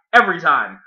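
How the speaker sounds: ordinary talk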